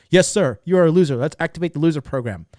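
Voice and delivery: loud, high, eager-to-obey yes-man; the subconscious mind obeying a self-insult, loud and eager. Retold or imitated. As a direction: bark it back like a keen servant. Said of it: "your subconscious mind".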